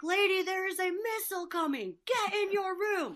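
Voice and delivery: a touch of mock panic at the guest; telling the guest off. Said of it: "I'm like".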